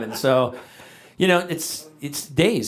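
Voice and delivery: normal talking voice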